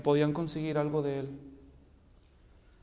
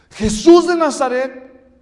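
loud, raised, drawn-out announcement; calling out; 7 semitones higher, 12 dB louder, slower than usual. Retold or imitated. Crowd telling the blind man who came, calling out. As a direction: call it out over a crowd. Acting the crowd answering Bartimeo.

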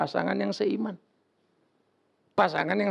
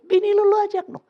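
high, loud urging; insisting; 13 semitones higher, 5 dB louder than usual. Retold or imitated. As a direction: press your friend hard to bring his wife. Pastor urging his friend. (mendesak teman dengan suara keras dan tinggi)

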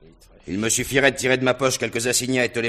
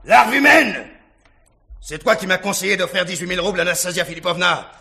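much higher and louder, raging; furious shout; 13 semitones higher, 8 dB louder than usual. Acted. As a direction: roar him out of the room. Rogojine raging at Lebedev.

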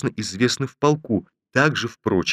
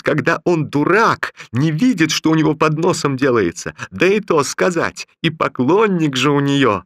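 high raised spiteful voice; threatening; 6 semitones higher than usual. Acted. Raisa threatening Romashov over her rival. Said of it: "кричал Бабетинский".

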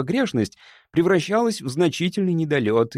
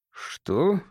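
higher-pitched baffled question; puzzled; 6 semitones higher than usual. A narrator's reading. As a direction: ask it in mild bewilderment. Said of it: "Я недоумённо заморгал". (недоумённый вопрос)

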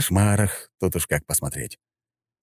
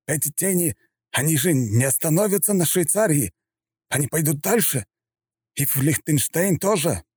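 plaintive general; 9 semitones higher than usual. Acted. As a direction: appeal pitifully for help. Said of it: "стал совсем жалобным".

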